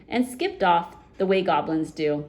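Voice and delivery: usual speaking voice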